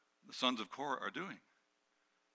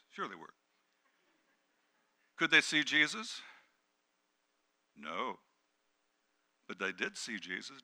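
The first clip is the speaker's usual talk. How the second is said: usual speaking voice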